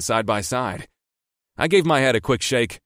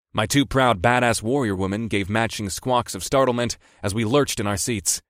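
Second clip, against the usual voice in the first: ordinary delivery, nothing marked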